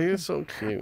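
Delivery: usual speaking voice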